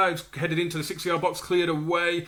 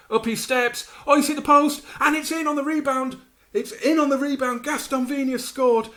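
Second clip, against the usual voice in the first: much higher, louder, thrilled commentary; goal celebration; 11 semitones higher, 4 dB louder than usual. Real, not acted. Watching his team score the rebound, thrilled shouting. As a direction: call the goal with delight.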